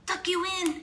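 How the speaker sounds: bellowed. A narrator's reading. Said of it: "he bellowed".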